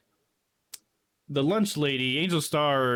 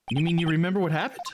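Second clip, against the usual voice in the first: ordinary delivery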